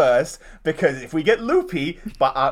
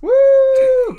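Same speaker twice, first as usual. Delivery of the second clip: high whooping cheer; excited cheer; 8 dB louder than usual. Real, not acted. Hyping the guest.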